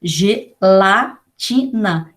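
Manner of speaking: Gelatina drawn out very slowly, each syllable apart; teacher sounding out Gelatina slowly; slow syllable demo. Shown as a demonstration. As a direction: stretch Gelatina to show its sounds. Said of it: "G com E".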